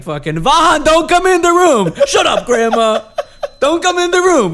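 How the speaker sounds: imitating grandma shrieking from the other room; grandma yelling. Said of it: "My grandma's like".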